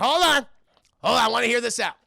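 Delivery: acted boss quieting the crowd; commanding interruption. Krampus halts the cheering elves.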